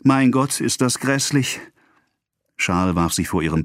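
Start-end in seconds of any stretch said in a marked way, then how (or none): 0.0-1.6 high-pitched, understated cry of agony; mild cry. A narrator's reading. Poisoned wife convulsing in pain, crying out. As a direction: cry out high without really shouting. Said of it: "Sie schrie".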